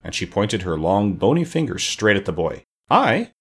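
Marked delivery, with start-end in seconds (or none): 2.9-3.3 very high-pitched startled cry; astonished high question; amazed. A narrator's reading. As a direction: squeak out the word in disbelief. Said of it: "cried Tip in amazement".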